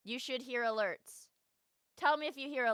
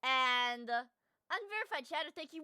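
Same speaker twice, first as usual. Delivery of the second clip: ordinary talk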